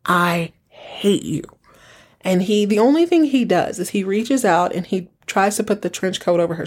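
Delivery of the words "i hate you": slow, hissed whisper of hatred; visceral whisper. Retold or imitated. Humiliated wife whispering her hatred at her husband. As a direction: breathe each word out slowly, full of loathing. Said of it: "it's visceral".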